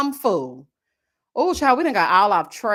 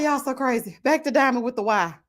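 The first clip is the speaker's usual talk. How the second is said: ordinary talk, nothing marked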